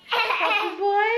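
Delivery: put-on high, slow sing-song baby talk. Cooing at baby.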